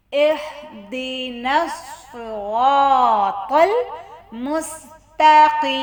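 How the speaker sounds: very slow, measured chant; Quran recitation. Shown as a demonstration. Teacher recites the verse for learners, slow chanted recitation. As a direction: draw each long vowel out on a held note.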